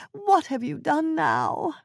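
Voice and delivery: high, slow, despairing plea; despairing old woman. Acted. Wife lamenting to her jailed husband, high and slow. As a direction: plead to the absent husband.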